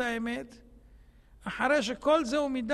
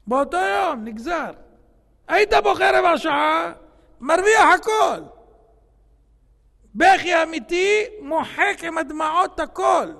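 fiery preaching shout; 5 semitones higher, 12 dB louder than usual. Real, not acted. Preacher shouting to the congregation that real tears erase everything. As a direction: shout it from the pulpit with fervour.

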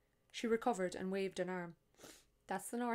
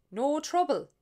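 wild-eyed; 8 semitones higher, 15 dB louder than usual. Acted. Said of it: "wild-eyed".

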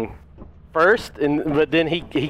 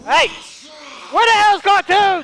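calling out; 11 semitones higher than usual. Real, not acted. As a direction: shout to find him. Calling out for lost friend.

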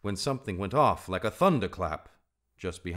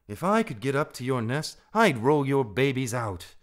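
loud, raised-pitch scolding threat; cross and forceful; exasperated; 7 semitones higher, 8 dB louder than usual. A narrator's reading. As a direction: snap at a foolish friend. Exasperated Rikki threatens singing Darzee.

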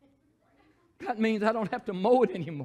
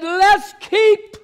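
devil's voice; 9 semitones higher, 14 dB louder than usual. Retold or imitated. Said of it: "he said".

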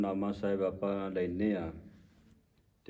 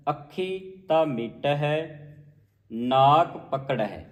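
slow, raised recitation stopping after ਪਕੜਹਿ; rest on ਪਕੜਹਿ; 3 semitones higher, 3 dB louder, slower than usual. Shown as a demonstration. Teacher modelling the rest, slow pause after ਪਕੜਹਿ. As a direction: stretch the words and rest at ਪਕੜਹਿ. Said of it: "ਪਕੜਹਿ ਤੇ ਵਿਸਰਾਮ ਹੈ".

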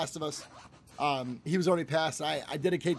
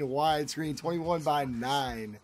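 slow, lower, oddly stretched "twenty-one by nine"; said weird; 4 semitones lower, much slower than usual. Real, not acted. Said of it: "Yes, I said that weird".

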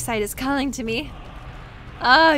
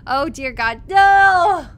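loud, rising cry of alarm; panicked shout; 4 semitones higher, 7 dB louder, slower than usual. Real, not acted. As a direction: yell it like disaster just hit. Panicked reaction to game danger.

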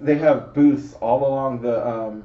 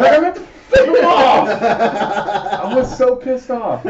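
laughing; 10 semitones higher, 5 dB louder, slower than usual. Real, not acted. Laughing at his own blunder.

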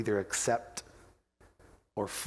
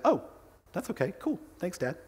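put-on small, higher, soft kid voice; mock casual acceptance; 5 semitones higher, 4 dB quieter than usual. Son breezily accepting dad's love, soft casual kid voice. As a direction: toss it off softly as a child. Imagined easy reply from son.

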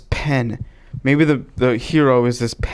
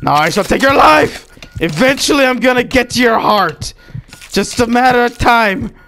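raised, high declamation; mock villain threat; 8 semitones higher, 4 dB louder than usual. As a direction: shout a theatrical vow of revenge. Vow builds to shouted threat.

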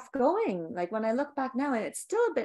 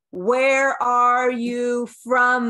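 drawn out very slowly and louder, each word stretched; slow loud leaning in; 11 dB louder, much slower than usual. Retold or imitated. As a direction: lean in and drag the question out, pushy. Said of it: "he leaned in over the counter and said".

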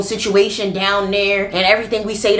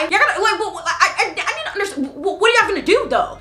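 laughing disbelief; 10 semitones higher, faster than usual. Real, not acted.

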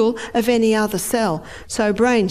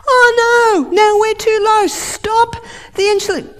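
much higher, louder wailing panic; frantic cry; 12 semitones higher, 6 dB louder than usual. Acted. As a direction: cry out in full alarm. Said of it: "brain panics again".

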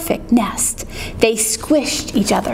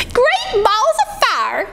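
high, slow exclamation; goose's drawn-out cry; 9 semitones higher, slower than usual. Acted. Lumbering goose spots the nest, high drawn-out cry. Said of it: "she cried".

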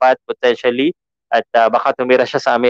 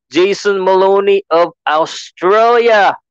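ring announcer; 6 semitones higher, slower than usual. Pretend.

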